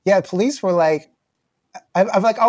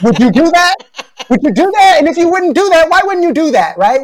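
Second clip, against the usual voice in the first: loud, high-pitched rhetorical questions; fired-up, insistent questioning; incredulous challenge; 10 semitones higher, 9 dB louder than usual. Real, not acted. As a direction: press the point, voice raised high over laughter. Challenges listener over the host's laughter.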